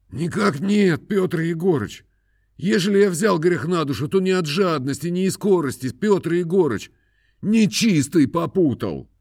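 high, plaintive peasant voice; pleading peasant. A narrator's reading. Khromoy pleads. (высокий жалобный голос мужика)

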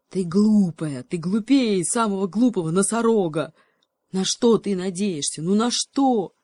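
loud, angry snarl; growling cheetah. Acted. Cheetah snarling at the monkey, loud and angry. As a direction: growl it at her. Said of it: "рычал гепард".

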